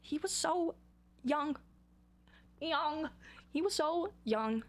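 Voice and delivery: high, halting, choked-up lament; hammed-up verge of crying; near tears. Real, not acted. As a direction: dwell on it, high and tearful. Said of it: "I'm gonna cry".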